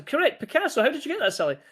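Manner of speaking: high-pitched mock congratulation; mock amazement. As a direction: feign astonishment at a lucky guess. Joking praise for a silly answer.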